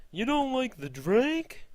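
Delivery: stupid high-pitched cartoon voice, loud and stretched out; rip-off B-movie voice, pushed very high; Jerry Seinfeld. Jerry coming out of the bathroom, silly high voice. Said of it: "do a stupid voice for Jerry Seinfeld".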